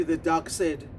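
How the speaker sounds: usual speaking voice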